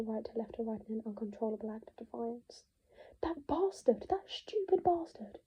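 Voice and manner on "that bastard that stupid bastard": higher, hissed and slow; furious curse. Acted. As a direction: spit the insult out. Furious about Nick.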